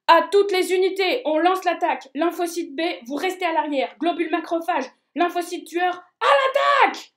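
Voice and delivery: loud battle command; rally the army to attack; commanding shout. Acted. General launches the attack.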